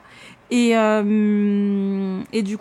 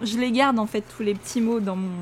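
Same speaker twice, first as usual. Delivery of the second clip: normal talking voice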